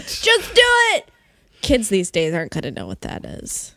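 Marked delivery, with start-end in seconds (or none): 0.0-1.1 big high shout; shouted slogan. Pretend. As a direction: yell it like an ad slogan.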